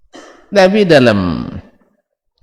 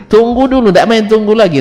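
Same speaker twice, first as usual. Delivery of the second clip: laughing; 11 semitones higher, 4 dB louder than usual. Real, not acted. Laughing at the unbelievers' plea.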